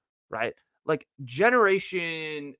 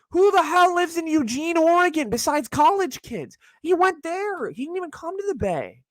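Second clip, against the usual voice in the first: exasperated; 13 semitones higher than usual. Real, not acted. Exasperated rant.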